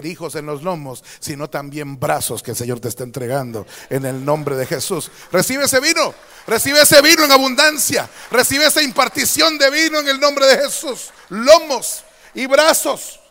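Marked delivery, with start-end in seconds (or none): 5.3-13.2 full-force preaching. Acted. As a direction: declare the blessing over them with force. Imparting blessing.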